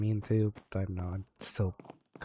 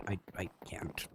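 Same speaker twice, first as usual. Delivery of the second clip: whisper; 3 semitones lower, 10 dB quieter than usual. Acted. Stammering, bloodstained, refusing.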